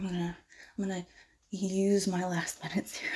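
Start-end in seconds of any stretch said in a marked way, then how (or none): 1.5-3.1 slow whisper. Acted. Seducing the professor.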